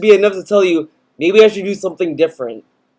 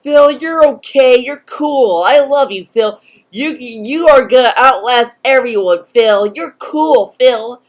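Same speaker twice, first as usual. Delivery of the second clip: exaggerated fawning comfort, pitched up; mock coddling fan; 5 semitones higher than usual. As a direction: gush over him as if babying him. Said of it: "he needs people to come and be like".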